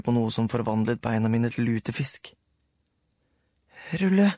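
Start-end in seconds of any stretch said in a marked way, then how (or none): 4.0-4.3 alarmed call. A narrator's reading. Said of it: "sa jeg".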